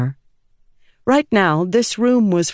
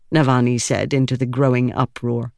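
ordinary delivery, nothing marked

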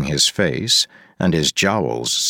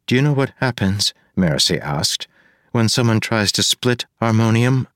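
put-on higher, quick young woman; 6 semitones higher than usual. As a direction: ask fast as a young woman. Marasi voice, fast.

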